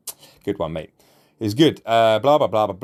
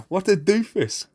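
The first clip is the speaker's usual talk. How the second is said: usual speaking voice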